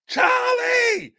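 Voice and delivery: high drawn-out yelled Charlie; shouted name. Retold or imitated. Grandson yelling his name up at his grandfather. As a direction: call the name out high and long.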